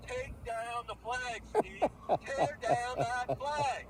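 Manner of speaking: slow, high, drawn-out declaration; mock presidential demand. Mocking a neighbour over his flag with a slow, high speech. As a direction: make it a grand speech, word by word.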